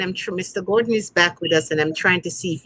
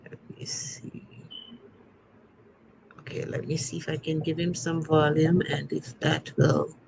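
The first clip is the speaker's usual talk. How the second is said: talking to oneself; 8 dB quieter than usual. Real, not acted. Host adjusting a guest's volume, muttering to oneself. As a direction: murmur it softly while busy with a task.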